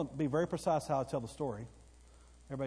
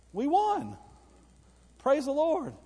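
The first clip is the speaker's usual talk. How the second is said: joyful exclaim; 10 semitones higher, 5 dB louder, slower than usual. Real, not acted. Victory over IRS told.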